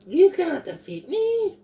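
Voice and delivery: shrill-pitched tiny goblin yelling; high goblin shout. Acted. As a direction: scream it high like a goblin warrior. Goblin Rika battle cry.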